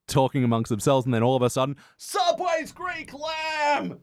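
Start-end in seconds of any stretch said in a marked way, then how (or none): 2.0-4.0 ad shout. Retold or imitated. Mimicking a loud podcast ad.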